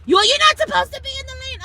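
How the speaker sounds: mock yell from a passing cyclist; shouted scolding. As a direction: yell it while riding past. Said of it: "riding away in his bike".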